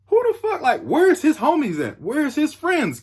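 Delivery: high-pitched retold disbelief; incredulous wondering. Acts out his thought mid-fight.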